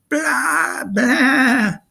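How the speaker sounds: very high, loud bleating; sheep bleat. Acted. Stabbed Polyphemus bleats like a sheep, very high and loud. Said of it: "bleated just like a sheep".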